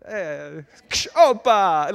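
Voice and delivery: put-on a silly stab at a Greek accent, shouted out; mock Greek accent. Preacher joking to the congregation, mock Greek shout. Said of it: "what's a Greek accent?".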